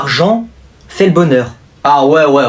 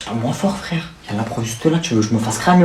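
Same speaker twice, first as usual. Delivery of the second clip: library whisper; 6 semitones lower, 7 dB quieter than usual. Acted. Hiding from teacher in class.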